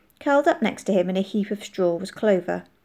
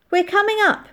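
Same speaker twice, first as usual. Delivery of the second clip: high, loud call; 13 semitones higher, 5 dB louder than usual. A narrator's reading. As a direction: call up to warn you're coming. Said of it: "she called".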